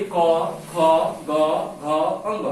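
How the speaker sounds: क, ख, ग, घ sounded one by one; throat sounds. Shown as a demonstration. Teacher showing where sounds form, throat letters sounded out. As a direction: sound out क, ख, ग, घ from the throat, pausing between. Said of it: "कंठ से आवाज आ रहा है".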